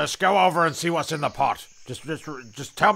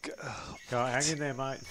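soft whispered encouragement; hushed, urging him to hang on; 11 dB quieter, slower than usual. Real, not acted. Whispering encouragement to stuck mate, hushed.

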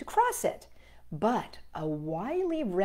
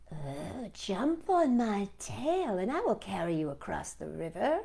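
soft, sly, softer fox voice; 4 dB quieter than usual. Acted. The wily fox luring the gingerbread man, soft and sly. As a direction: coax like a crafty fox. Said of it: "he called".

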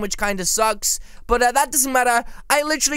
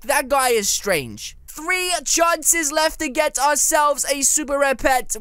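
ordinary delivery

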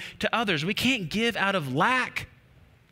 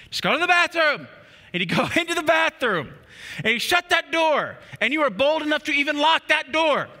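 higher, louder dramatic storytelling; mock desperate mom; 8 semitones higher, 3 dB louder than usual. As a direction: ham up the mom's quest. Mom acting out bathroom escape.

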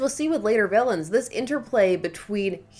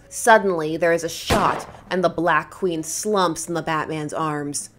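normal talking voice

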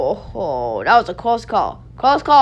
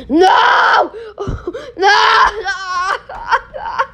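laughing cry; 7 semitones higher, much slower than usual. Real, not acted. Tower falling again.

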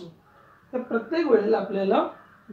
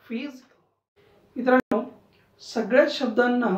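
usual speaking voice

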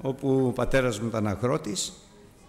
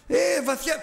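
put-on high, sheepish little-boy voice; boy lying to his father, high and timid; child's fib; 12 semitones higher, 3 dB louder, slower than usual.

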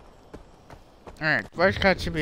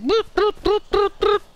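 high, loud bloop noises; imitating bloop sound; 13 semitones higher, 5 dB louder than usual. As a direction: mimic a repeating game beep. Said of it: "Y Spot always like".

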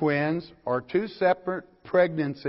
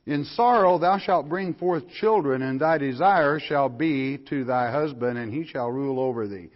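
louder, lifted pulpit reading of scripture; preacher reading the King James aloud; 5 dB louder than usual. Acted. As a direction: proclaim the verse to the congregation. Reads scripture aloud, raised.

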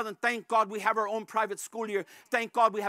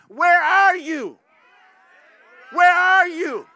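loud, high, slow cry of where are you; preacher's pleading shout; shouted appeal; 7 semitones higher, 10 dB louder, slower than usual. Real, not acted. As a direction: call them out from the pulpit. Pastor calling out to Christians.

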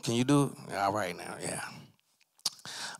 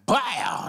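high, punchy "bow" noise; mouthed sound effect; 11 semitones higher, 3 dB louder than usual. Acted. Suspect demonstrates his nose-touch noise, mouthed sound effect. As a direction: pop out a comic impact noise. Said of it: "gotta make the sound effect".

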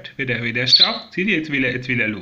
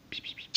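soft imitated bird chirps; quiet peep peep bird calls; chirping call; 17 dB quieter, faster than usual. Shown as a demonstration. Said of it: "wenn ich piep, piep mache".

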